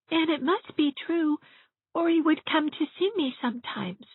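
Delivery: put-on high, small child's voice, wistful; a lonely little girl confiding; little girl's voice. Elizabeth telling Miss Shirley her father can't bear her, small and wistful.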